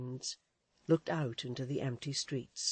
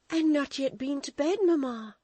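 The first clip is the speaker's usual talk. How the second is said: put-on high, loud worried girl's question; 11 semitones higher, 7 dB louder than usual. Florence worried her mother has not slept. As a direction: ask her anxiously.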